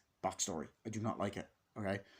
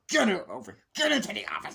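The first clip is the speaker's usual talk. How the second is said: high, loud hammed-up order; mock principal yell; 10 semitones higher, 8 dB louder, faster than usual. The principal ordering Michael to the office, high mock yell. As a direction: bark it like an angry principal.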